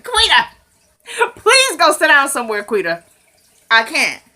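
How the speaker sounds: high, pleading exasperation; exasperated scolding. Real, not acted. Viewer telling Quita off, amused and exasperated.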